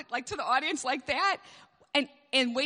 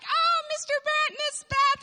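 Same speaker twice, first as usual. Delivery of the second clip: put-on much higher, louder child voice; imitating the kids; 5 dB louder than usual. Kids spotting the puppeteers, high imitated squeal. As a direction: squeal it like surprised preschoolers.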